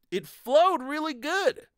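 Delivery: normal talking voice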